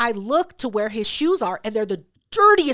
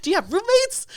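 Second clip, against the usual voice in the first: mock surprise; 10 semitones higher than usual. Mocking friend's surprised questions.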